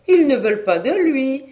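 high, loud incredulous exclamation; indignant disbelief. A narrator's reading. Repeats it, more indignant. (exclamation incrédule, haute et forte)

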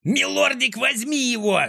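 high, strained yell to a dog; frantic high-pitched urging; straining yell. A narrator's reading. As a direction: scream the command at the top of your range. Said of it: "надрывался Клопс".

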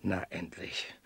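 soft relieved whisper. Acted. The schemer whispers a secret greeting to his hired man. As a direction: breathe it out under your breath.

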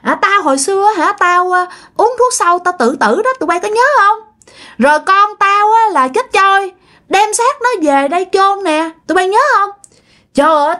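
possessed man's voice. Retold or imitated. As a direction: gloat like a spirit owning the murder. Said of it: "Ổng nói vậy đó, ổng nói rằng á".

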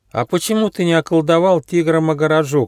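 put-on high curious child voice; little hedgehog voice. A narrator's reading. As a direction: ask like a curious little kid. Hedgehog character voice.

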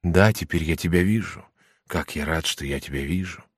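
тихо и покорно, затихая к концу; смиренно, почти шёпотом; quiet humble. A narrator's reading. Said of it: "всё так же тихо". (тихо, с поникшей головой)

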